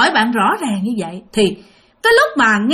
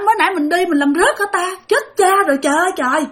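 high, rushed panic over lost keys; frantic searching, pitched up; panicked; 7 semitones higher, faster than usual. Retold or imitated. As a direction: voice the rising alarm of a frightened boy.

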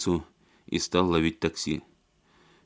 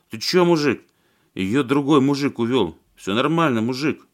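put-on drunk thug; 5 semitones higher, 5 dB louder than usual.

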